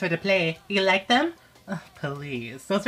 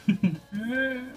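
short sly laugh, a little higher; villainous laugh; 7 semitones higher than usual. Acted. Pop star villain gloating to herself, sly laugh.

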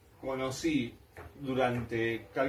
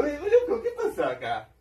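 laughing surprise; 12 semitones higher, 5 dB louder than usual. Retold or imitated. Reacting to the voice.

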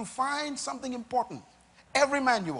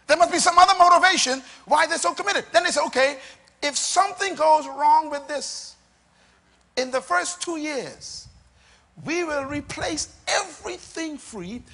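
loud, high-pitched preacher's drive; raised, emphatic pulpit voice; forceful preaching; 7 semitones higher, 9 dB louder than usual. Real, not acted. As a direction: preach it hard, pressing the question on the congregation.

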